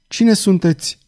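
high, distraught question; crazed voice. A narrator's reading. Cardinal facing a stranger, distraught crazed question. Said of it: "cu un glas de ieșit din minți".

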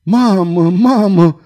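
high, loud wail for mother; sobbing cry. A narrator's reading. Girl calls to her dying mother, sobbing cry. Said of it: "hohoti Violeta".

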